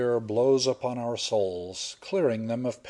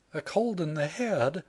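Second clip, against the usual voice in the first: higher, questioning heroine voice; higher, puzzled young woman's echo; incredulous echo; 6 semitones higher than usual. Acted. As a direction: repeat it in disbelief.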